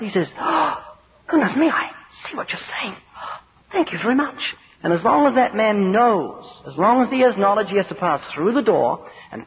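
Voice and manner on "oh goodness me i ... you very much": higher-pitched, hammed-up shock; acted flustered passenger, pitched up; alarmed realisation. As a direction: gasp as the danger dawns, then gush thanks. Passenger suddenly grasps the danger.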